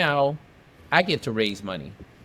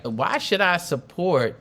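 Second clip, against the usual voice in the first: slow imitated Southern drawl, rising high at the end; slow Southern senator voice, lightly put on; 4 semitones higher than usual. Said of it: "he got this heavy Southern drawl".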